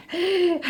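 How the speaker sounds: high amused laugh; real laugh. Real, not acted. Vlogger watches Anamika climbing, amused laugh.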